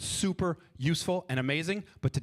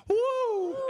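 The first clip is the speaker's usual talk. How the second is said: high loud release cry; high woo; 3 dB louder than usual. Shown as a demonstration. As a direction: let it all out with a woo. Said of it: "Five is a woosah".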